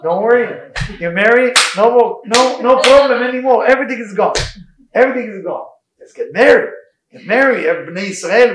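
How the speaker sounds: loud, high-pitched, emphatic reassurance; exuberant, insistent reassurance at high volume. Real, not acted. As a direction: raise your voice and pitch to wave every worry away. Emphatically reassuring audience about marriage.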